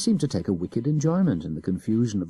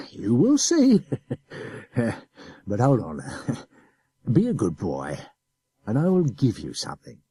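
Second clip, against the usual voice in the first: put-on chuckling, louder officer coaxing the boy; laughing adjutant; 3 semitones higher, 3 dB louder than usual. A narrator's reading. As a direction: laugh it off while threatening. Adjutant coaxes.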